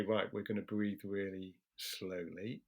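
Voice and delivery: slow, soft, reassuring instruction; calm and slow. Shown as a demonstration. Talking a panicking person through breathing, soft and slow. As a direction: guide their breathing gently and slowly.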